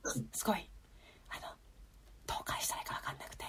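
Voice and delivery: hushed unvoiced whisper, barely audible. Real, not acted. Telling the cohost about an awkward moment, whispered. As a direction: breathe it out so the host can hardly hear. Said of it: "ウィスパーすぎ".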